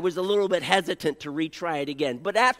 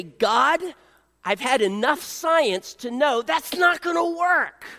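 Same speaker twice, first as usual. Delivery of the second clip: loud exasperated plea, half-joking; mock protest to God; 5 semitones higher, 4 dB louder than usual. Preacher mock-arguing with God, loud and exasperated. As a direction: throw up your hands at God, mock-incredulous.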